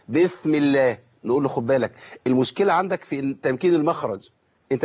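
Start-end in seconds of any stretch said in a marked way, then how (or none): 0.1-1.0 "بسم الله" as "bithmillah"; lisped sin. Retold or imitated. Teacher imitating someone with articulation trouble, lisped "بسم الله". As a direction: put the tongue between the teeth on the s of "بسم". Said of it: "حد منهم يقرا يقول ايه".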